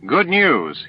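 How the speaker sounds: bright high announcement, a touch delighted; pleased herald announcing success; announcing good news. A narrator's reading. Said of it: "he announced".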